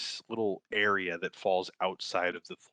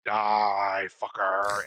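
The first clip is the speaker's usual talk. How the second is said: loud mock battle cry; 7 dB louder, much slower than usual. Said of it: "so I'll just be like".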